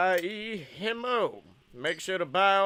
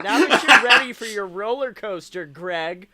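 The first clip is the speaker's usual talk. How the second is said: mock cheerful ride attendant; 3 semitones higher, 9 dB louder than usual. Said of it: "And we're like".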